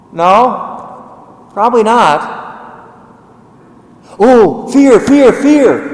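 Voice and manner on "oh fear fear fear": mock panic, loud and high; hammed-up alarm, pushing fear; fearmongering voice. Preacher mocking a scaremongering voice with staged alarm. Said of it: "Is that the Father's voice".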